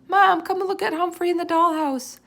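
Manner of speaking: high, loud excited call; shouting. Acted. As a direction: call out to the next room. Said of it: "she shouted".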